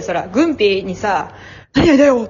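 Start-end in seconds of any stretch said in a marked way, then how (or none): 1.7-2.2 raised, louder Tokyo-accent mimicry; mock Tokyo speech. Teasing co-host over turning Tokyo, mock Tokyo accent. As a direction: put on a Tokyo accent, pitched up. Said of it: "東京五日間おったらなる".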